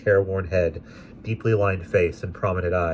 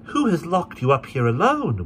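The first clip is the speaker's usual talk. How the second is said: put-on higher concerned young woman; young lady; 10 semitones higher than usual. A narrator's reading. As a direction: ask gently with concern. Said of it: "we naturally asked".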